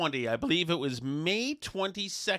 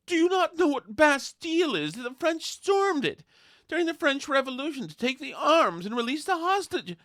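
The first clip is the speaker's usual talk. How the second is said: condescending; 11 semitones higher than usual. Retold or imitated. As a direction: talk down to her like a smug expert. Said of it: "It was so wonderfully condescending".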